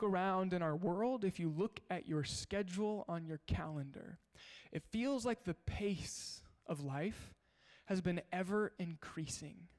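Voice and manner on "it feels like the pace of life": ordinary talk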